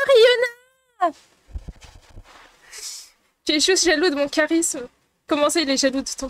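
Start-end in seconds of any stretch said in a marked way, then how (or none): 0.1-1.1 a high, loud delighted cry of the name; excited shout-out. Real, not acted. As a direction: call the follower's name out with joy. Thanking a new follower excitedly. (cri de joie, aigu et fort)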